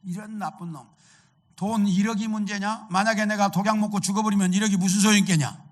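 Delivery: acted angry outburst, high and loud. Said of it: "이 청년이 화를 내면서". (화를 내며 높고 크게 쏘아붙이는 연기)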